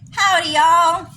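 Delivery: high, loud put-on Texan; exaggerated mock Texas greeting; fake Texas accent. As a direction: holler it like a phony Texan. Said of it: "It sounds really fake".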